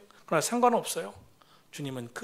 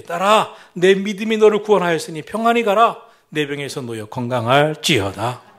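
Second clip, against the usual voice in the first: proclaiming Jesus; 10 dB louder than usual. Acted. Jesus declares healing to the woman, loud proclamation. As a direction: declare it over her with authority.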